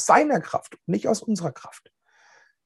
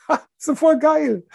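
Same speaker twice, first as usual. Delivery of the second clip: laughing delight; 11 semitones higher, 3 dB louder than usual. Real, not acted. Preacher delighted by the story.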